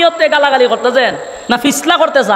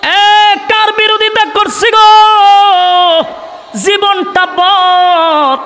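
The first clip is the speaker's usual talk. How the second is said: hypocrites' wailing cry; 4 semitones higher, much slower than usual. Acted. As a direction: cry it out high, stretching the last words. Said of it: "মুনাফিকরা বলতে লাগলো".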